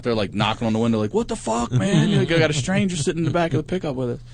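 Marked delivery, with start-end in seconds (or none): 1.1-4.2 imitating panicked friends, pitched up; high, hurried mock protest; freaking out. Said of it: "they're freaking out".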